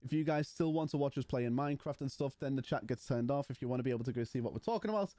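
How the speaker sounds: ordinary delivery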